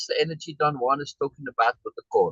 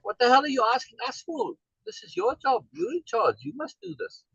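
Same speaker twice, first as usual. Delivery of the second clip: higher, mock-irritated scolding of him; imitating annoyed scolding; 11 semitones higher than usual. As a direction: play the exasperated woman telling him off. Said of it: "And almost scolds me".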